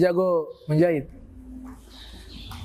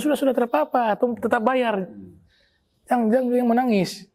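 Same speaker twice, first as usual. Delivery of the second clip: comforting, pitched much higher; calm him down like a worried elder; a higher, soothing voice to someone crying; 8 semitones higher than usual. Retold or imitated. Scholar comforts the crying tailor.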